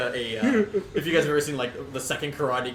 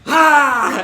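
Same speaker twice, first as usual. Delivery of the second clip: high loud fake lunge yell; threatening shout; 16 dB louder than usual. Woodchuck feints at the hostage, shouting. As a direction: snap a sudden "Ah!" to make him flinch. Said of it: "he shakes his fist and he goes".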